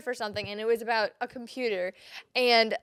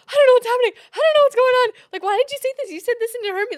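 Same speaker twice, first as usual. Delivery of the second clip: much higher, loud, wailing; mock upset wail; 12 semitones higher, 11 dB louder, much faster than usual. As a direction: play the hurt, bewildered partner. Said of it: "and I'm like".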